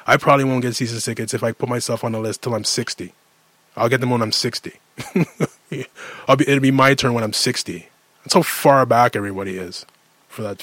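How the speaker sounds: usual speaking voice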